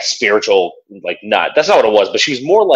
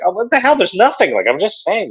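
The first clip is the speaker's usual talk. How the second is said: much higher, exasperated imitation of the boyfriend; high exasperated mock; 12 semitones higher than usual. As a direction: protest in a high, fed-up put-on voice. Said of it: "He's like".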